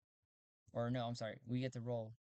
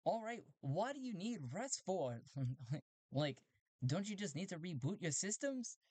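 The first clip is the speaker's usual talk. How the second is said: high, teasing robot; 5 semitones higher than usual. Acted. Robot teasing the human pilot, high voice. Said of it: "The robot on the other end goes".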